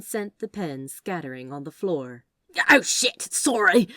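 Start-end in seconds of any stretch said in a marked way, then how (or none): 2.7-3.9 loud, sudden yelp of dismay; startled outburst. Acted. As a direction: blurt it out as the pens go flying. Knocks pens off the desk.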